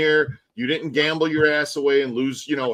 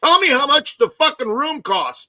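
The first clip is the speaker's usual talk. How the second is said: higher, louder frustrated yell; angry outburst; 10 semitones higher, 6 dB louder than usual. Retold or imitated. Fed-up guest yelling at the hotel clerk, loud and angry.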